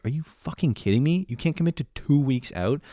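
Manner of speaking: high-pitched incredulous questioning; rising, outraged disbelief without raising volume; retold fury. Dungeon master confronts friend who won't commit, furious disbelief. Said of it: "I was so fucking furious".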